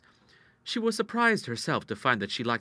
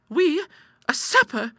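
very high, louder questioning; startled disbelief; 6 dB louder, slower than usual. Acted. Sister hearing of a supper plan, high startled disbelief. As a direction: echo it in astonishment.

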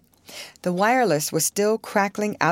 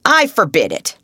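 loud, higher-pitched forbidding cry; emphatic raised-voice objection; indignant exclamation; 4 semitones higher, 7 dB louder than usual. A narrator's reading. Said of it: "exclaimed Mister Sneed".

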